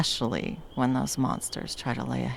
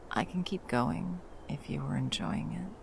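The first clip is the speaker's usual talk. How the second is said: soft, coaxing whisper; 6 dB quieter than usual. Acted. Offering to keep telling the story, a coaxing whisper. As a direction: hush it close and inviting.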